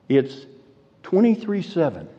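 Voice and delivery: usual speaking voice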